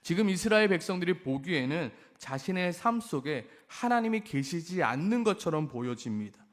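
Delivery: ordinary talk, nothing marked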